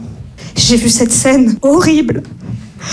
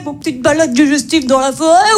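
mock relative suggesting a walk. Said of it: "qui se lève et qui te fait".

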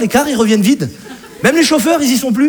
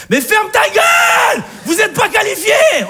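angry outburst; 13 semitones higher than usual. Acted. Comic yelling at the complaining fans, high furious shout. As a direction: yell it at them, losing it. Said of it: "là je m'énerve".

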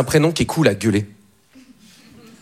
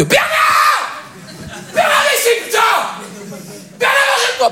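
furious shout, much higher and slow; parent yelling. Retold or imitated. Parent scolding a child named Bernard, furious shout. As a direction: bellow the kid's name with all your rage. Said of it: "si tu l'appelles avec le mauvais prénom".

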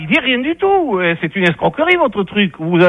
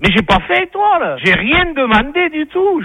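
higher-pitched, exasperated denial; indignant protest; 6 semitones higher than usual. Real, not acted. Prank victim protests being charged.

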